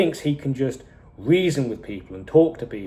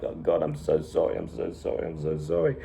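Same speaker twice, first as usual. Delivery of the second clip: lower, softer, fading into a breathy whisper; devastated apology; 8 semitones lower, 7 dB quieter than usual. Retold or imitated. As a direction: whisper the apology over the body, broken. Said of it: "he is devastated".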